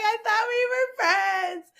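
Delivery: very high, hammed-up tearful whine; mock ugly crying. Retelling hurt plea to travel friend, mock sobbing. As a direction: wail it in comic heartbreak. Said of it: "I cried, like, with her, very ugly".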